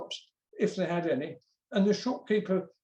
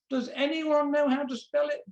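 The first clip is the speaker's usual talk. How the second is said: raised, high schoolmistress voice; teacher addressing class; 7 semitones higher than usual. A narrator's reading. Teacher asks all the pupils, high questioning voice.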